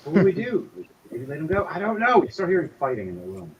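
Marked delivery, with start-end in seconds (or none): 0.0-2.2 panicked arguing captors. Acted.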